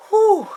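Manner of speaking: a long blown-out whew, like crossing a finish line; hammed-up sigh of relief; relieved sigh. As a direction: puff out the breath in mock relief. Exhausted relief after the push.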